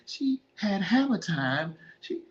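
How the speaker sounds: Eddie Murphy. Retold or imitated. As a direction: stretch it out like a disgusted film punchline. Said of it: "Like Eddie Murphy from Boomerang".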